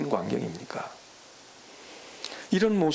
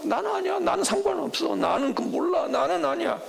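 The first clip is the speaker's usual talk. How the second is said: panicked denial; 13 semitones higher than usual. Acted. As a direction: shout it to save your skin. Disciples voiced denying Jesus.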